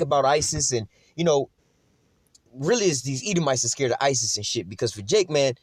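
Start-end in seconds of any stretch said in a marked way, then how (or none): none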